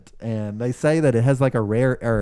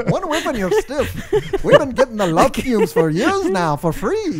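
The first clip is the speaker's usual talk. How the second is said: lilting Irish accent, pitched up; 10 semitones higher than usual. Shown as a demonstration. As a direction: put on an Irish village voice. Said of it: "you gotta say it in an Irish accent".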